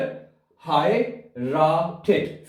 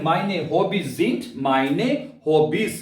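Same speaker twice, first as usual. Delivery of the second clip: very slow model phrase, rising at the end; very slow demo; much slower than usual. Shown as a demonstration. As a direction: drag out each word for learners. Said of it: "आप बता सकते हैं". (बहुत धीमे बोलकर सिखाना)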